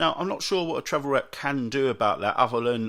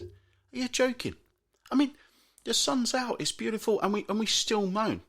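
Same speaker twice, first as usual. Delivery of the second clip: pitched way up in disbelief; exasperated disbelief; 12 semitones higher than usual. Real, not acted. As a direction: rant at the moaners, incredulous. Mocking the moaners, exasperated.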